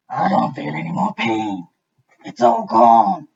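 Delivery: crocodile booming with relief; relieved crocodile. A narrator's reading. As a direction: crocodile realizing the pain is gone.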